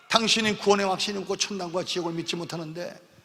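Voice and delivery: ordinary talk, nothing marked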